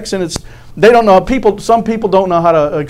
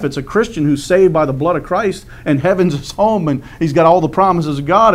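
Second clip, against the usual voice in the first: ordinary talk, nothing marked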